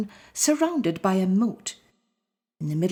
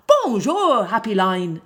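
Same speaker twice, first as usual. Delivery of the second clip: squirrel voice; 2 semitones higher, 5 dB louder than usual. Acted. Squirrel answers.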